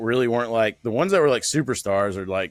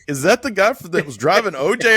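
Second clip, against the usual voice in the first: mock curious question; 9 semitones higher, 5 dB louder than usual. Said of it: "they were like".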